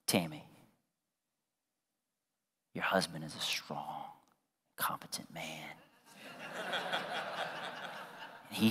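low, hushed, near-whispered voice; mock Holy Spirit. Holy Spirit ministering to wife, hushed mock whisper.

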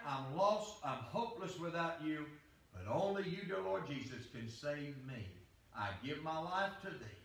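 humble prayer. Shown as a demonstration. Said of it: "surrender and say".

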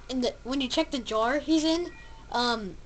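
ordinary talk

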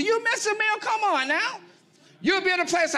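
high preacher call. Real, not acted. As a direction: lift it up high to the crowd. Preacher urging the congregation.

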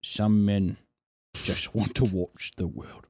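low, slow, menacing Joker voice; Joker line. Retold or imitated. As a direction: growl it slowly like the Joker. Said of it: "did the exact Joker line".

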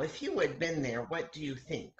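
usual speaking voice

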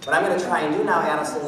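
ordinary talk, nothing marked

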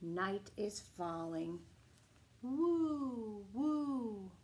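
gentle bedtime story reading; reading to children. Acted. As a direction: bedtime picture book read-aloud. Reading picture book aloud.

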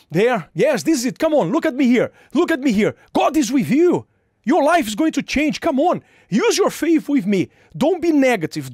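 urgent rising preaching; hurrying someone. Real, not acted. Preacher urging congregation.

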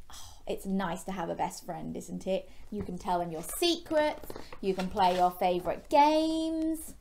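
ordinary delivery, nothing marked

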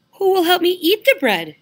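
little red hen voice. Acted. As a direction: ask it in a high hen voice. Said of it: "she asked".

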